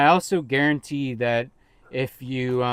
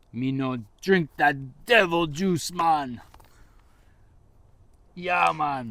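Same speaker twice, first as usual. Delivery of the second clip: slow, lilting Jamaican patois, lightly done; a hint of Jamaican patois, slowed down; mock Jamaican accent; slower than usual. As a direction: play a laid-back Jamaican man.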